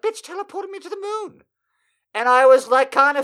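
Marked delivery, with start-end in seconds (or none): 0.0-1.4 much higher, pinched disbelief; high mock outrage.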